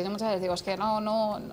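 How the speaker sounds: usual speaking voice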